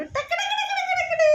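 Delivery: very high, squealing tak tak tak; squeaky tickle climax. Real, not acted. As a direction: burst up high as the tickling starts.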